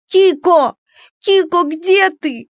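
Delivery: high, desperate drawn-out call. A narrator's reading. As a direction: call into the distance for a lost friend. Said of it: "продолжая звать подругу". (отчаянный протяжный зов)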